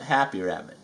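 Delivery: usual speaking voice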